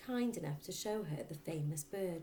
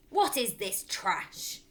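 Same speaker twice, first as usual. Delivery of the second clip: angry father; 3 semitones higher, 7 dB louder, slower than usual. A narrator's reading. Angry father snatches the book.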